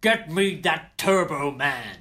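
slow, drawn-out order in a put-on voice; comic general demanding it, drawn out slowly; hammed-up general. General demanding the toy from the TV parade, hammed-up. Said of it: "where some general is sat watching the, watching the parade".

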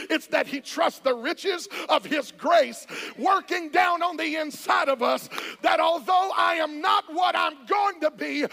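loud rising sermon cadence; full-force preaching. Acted. Pastor pounding out grace to the congregation. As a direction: drive each phrase home.

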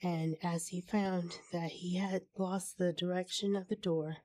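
usual speaking voice

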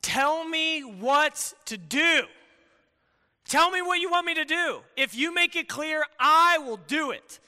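imitating an earnest plea to God; pleading prayer. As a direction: press God to make it clear. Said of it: "Have you ever said to God".

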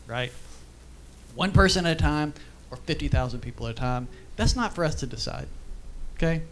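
ordinary talk, nothing marked

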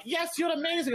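high, sugary praise; mock the overly affirming teacher; gushing teacher.

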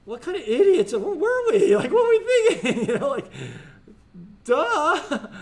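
real laughter breaking into the question; laughing. Real, not acted. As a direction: laugh as you ask it. Laughing at own rhetorical question.

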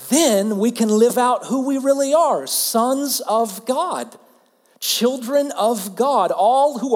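fervent preacher voice; impassioned preaching. Real, not acted. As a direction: rise to the climax of the sermon. Preaching climax.

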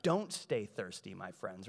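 low-pitched mock-suave catchphrase; silly Jesus dropping low, trailing off softly. Preacher imagines Jesus joking about thirst, silly low voice. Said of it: "if he was silly, he would be like".

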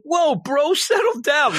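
high, laughing bro voice; a hammed-up chill bro; laid-back bully. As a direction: wave him off like a smug bully. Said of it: "He's like".

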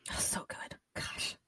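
breathless hushed whisper; awed whisper. Real, not acted. Overwhelmed by the story, she whispers in awe.

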